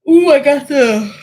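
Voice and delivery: loud, slow talk through a yawn. Real, not acted. Host catching a yawn mid-stream, loud and slow. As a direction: let a yawn take over the words.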